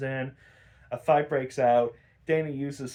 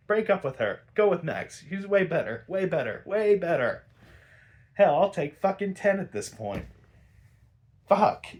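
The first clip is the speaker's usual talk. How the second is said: frustrated outburst; 5 semitones higher, 4 dB louder than usual. Real, not acted.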